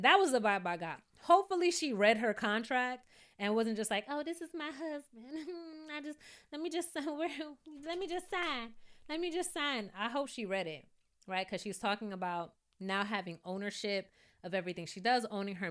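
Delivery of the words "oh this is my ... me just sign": higher, soft, giggly airhead voice; mock ditzy. Mocking a wife signing blindly, giggly and airy. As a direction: play her as dreamy and careless.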